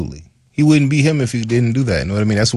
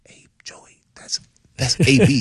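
hushed aside; 5 semitones higher than usual. Retold or imitated. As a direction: drop to a whispered, discreet word. Calming an upset teammate in retold talk.

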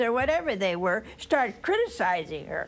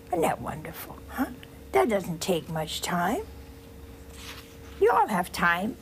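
ordinary delivery

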